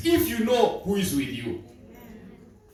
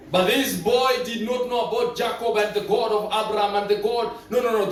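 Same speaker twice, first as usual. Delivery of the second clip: normal talking voice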